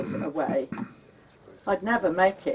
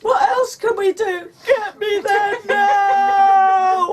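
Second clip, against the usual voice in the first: high, loud, drawn-out crying plea; sobbing shout; 14 semitones higher, 8 dB louder, slower than usual. Retold or imitated. Wife pleading with husband to rush her, sobbing shout. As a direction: cry it out in desperation. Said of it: "I was sobbing".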